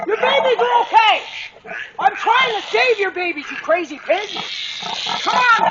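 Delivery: frustrated shouting. Real, not acted. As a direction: yell over her to calm her down. Shouting at charging sow.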